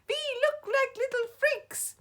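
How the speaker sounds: very high squeaky shout; child shouting to the others. A narrator's reading. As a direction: squeal in horror. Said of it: "Another shouts".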